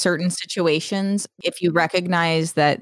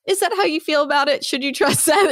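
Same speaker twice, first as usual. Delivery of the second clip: doubting inner voice; 10 semitones higher than usual. Retold or imitated. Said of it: "And it's like".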